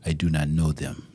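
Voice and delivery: low, soft mock European accent. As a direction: deny it as the European man, deep and quiet. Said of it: "That's your European accent?".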